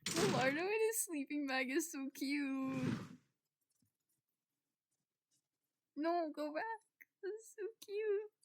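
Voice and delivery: high-pitched gushing over something cute; cooing adoration. Real, not acted. Gushing over cute sleeping character.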